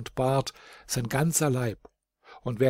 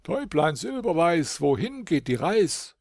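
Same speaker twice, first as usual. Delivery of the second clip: higher, lilting call to someone above; 5 semitones higher than usual. A narrator's reading. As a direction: call up to the birds in rhyme. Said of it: "rief hinauf zu ihnen". (singend hinaufgerufen)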